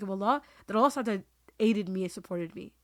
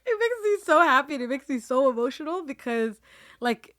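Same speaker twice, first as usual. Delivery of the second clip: fighting back happy tears; choked up with joy; getting emotional; 5 semitones higher than usual. Real, not acted. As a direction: voice rising and tearful, close to crying. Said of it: "Sorry, I don't wanna get emotional".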